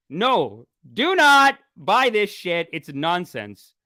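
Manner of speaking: slow down and hammer each word; firm warning. Real, not acted. Warning viewers about scam.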